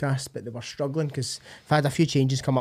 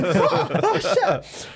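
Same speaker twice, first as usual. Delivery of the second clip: high-pitched startled cry through laughter; mock alarm; much slower than usual. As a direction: yelp as if caught slacking, hammed up. His startled reaction to Goggins video.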